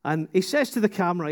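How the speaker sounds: ordinary delivery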